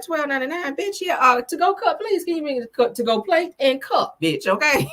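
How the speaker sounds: high, sassy mock indignation; hammed-up diva complaining about the bill; sassy outrage. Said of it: "I was like".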